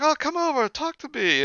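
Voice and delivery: high, sing-song friendly invite; a doting mother beckoning, voice way up; welcoming mother voice; imitating his mother. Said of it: "she's like".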